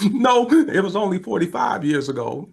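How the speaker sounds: high mock-weepy voice; tearful correction. Tearful caller correcting the old man, imitated weepiness. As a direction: correct him through acted tears.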